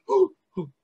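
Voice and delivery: high, loud startled gasp and ooh. Acted. Caught by God, a high loud gasp. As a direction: gasp like you've been caught.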